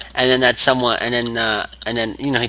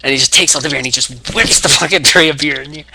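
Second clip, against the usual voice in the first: ordinary delivery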